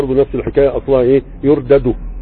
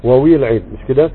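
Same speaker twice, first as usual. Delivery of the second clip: normal talking voice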